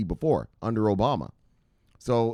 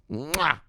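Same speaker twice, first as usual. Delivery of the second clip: kiss sound; 5 semitones higher than usual. Pretend. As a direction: blow a big playful kiss. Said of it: "Give him a big old kiss".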